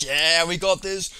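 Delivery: loud, drawn-out rallying line; excited retold cheer; mock team hype. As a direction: imitate a pumped-up teammate. Acted sudden team spirit.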